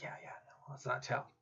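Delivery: a hushed, voiceless aside; whispered secret. Acted. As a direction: keep it between the two of us. Conspiring to hide a secret.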